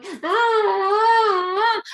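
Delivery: put-on high, slow drawn-out toddler cry; imitated toddler whine. Toddler in the kitchen wanting to be picked up, whining. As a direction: whimper like a clingy toddler. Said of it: "next to you in the kitchen going".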